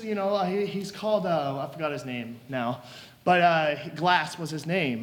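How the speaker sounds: normal talking voice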